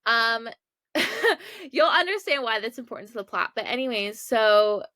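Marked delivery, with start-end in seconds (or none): 0.9-1.7 brief high-pitched giggle; short laugh. Real, not acted. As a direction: let a little laugh slip out. Laughs before hinting at the plot.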